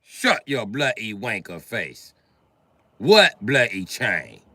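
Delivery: put-on British accent, drawn-out then offhand; British bully. British bully denying he took the chain, British accent. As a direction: deny it as a British tough. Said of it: "Oh, he's British".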